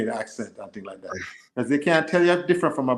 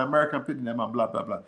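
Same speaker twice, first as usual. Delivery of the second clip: usual speaking voice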